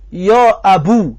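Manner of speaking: «یا ابو» slow, ending on a rounded "u"; drawn-out «یا ابو»; u ending demo. Shown as a demonstration. Teacher modelling the u form for students, slow. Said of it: "گفتنم جایز است".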